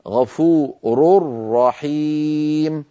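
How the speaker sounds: very slow "غفور رحيم", tanween merged into a doubled ra; recited with idgham. Shown as a demonstration. Teacher reciting the tanween example very slowly with merged nun. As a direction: say "غفور رحيم" as "ghafurur-rahim", no n. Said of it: "يصبح النطق هكذا".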